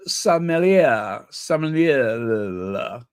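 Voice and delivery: tongue-tied "sommelier", dragged out and ending in "-la"; fumbled pronunciation. Real, not acted. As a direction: attempt "sommelier" as "som-melia", then slur it into "som-nila-la". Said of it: "how the hell do you pronounce that".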